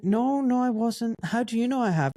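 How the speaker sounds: high, insistent denial; retold protesting denial. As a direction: re-enact disputing the charge, pitched right up.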